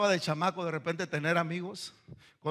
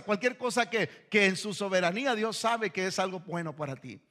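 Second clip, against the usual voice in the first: ordinary talk, nothing marked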